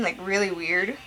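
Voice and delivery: usual speaking voice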